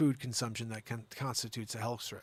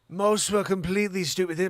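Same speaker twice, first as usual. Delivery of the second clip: put-on loud, higher English accent in actor style; 4 semitones higher, 6 dB louder than usual. Host reads actor news, mock English accent. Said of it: "My English accent is essentially Jeremy Irons".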